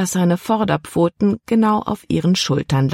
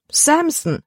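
the name cried out much higher in dismay; 12 semitones higher than usual. A narrator's reading. Libby cries out the dog's name in dismay. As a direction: exclaim the name in alarm. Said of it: "rief Libby".

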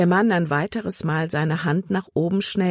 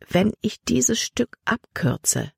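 drawn-out, higher musing; slow, pondering thought; 6 semitones higher, slower than usual. A narrator's reading. Libby thinks about cutting across the ice, slow pondering. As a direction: think it through slowly. Libby weighs a risky shortcut.

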